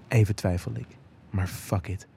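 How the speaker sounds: usual speaking voice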